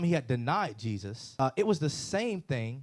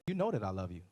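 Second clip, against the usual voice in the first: normal talking voice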